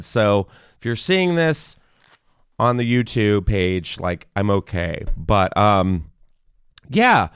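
ordinary talk

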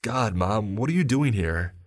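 put-on a girl caught out and mortified; mortified daughter. Daughter caught by her mother, high mortified outburst.

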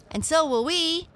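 high, loud chiming in; eager boys. A narrator's reading. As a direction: pipe up eagerly as a bunch of boys. Boys eagerly join the search.

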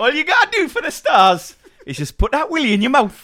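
very high, loud Geordie presenter laughing; cheerful Geordie host voice; mock Dec coaching; Dec impression. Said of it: "Deck doing a".

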